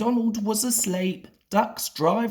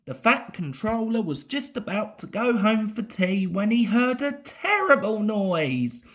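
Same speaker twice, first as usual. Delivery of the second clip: ordinary delivery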